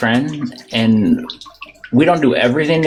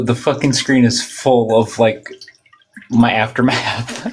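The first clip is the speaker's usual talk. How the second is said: ordinary talk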